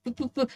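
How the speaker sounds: angry stammer. Retold or imitated. Stammering in anger.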